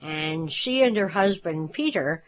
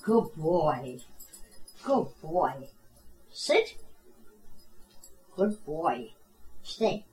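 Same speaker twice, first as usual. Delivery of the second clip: firm dog commands; 7 dB quieter, slower than usual. Real, not acted. Owner praising and settling dog.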